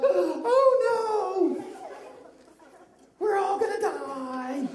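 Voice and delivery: high gasping fake panic; mock terror. Shown as a demonstration. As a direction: squeal it like a scared cartoon. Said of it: "put your hand in your face like".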